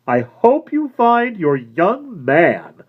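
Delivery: slow, high, sneering; nasty. A narrator's reading. Florist mocking the woman as she leaves, slow and nasty. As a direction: twist the knife. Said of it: "gave it a nasty sound".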